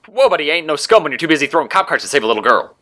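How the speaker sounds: quick, high-pitched banter; cocky speedster. A narrator's reading. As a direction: fire it back fast and high, teasing the hero. Speedster villain banters back.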